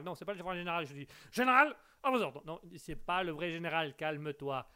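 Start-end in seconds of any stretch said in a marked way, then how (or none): 1.3-2.4 loud, high military shout; shouted salute. Acted. Soldier reports to the general with a loud high shout. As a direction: bark it like a soldier on parade.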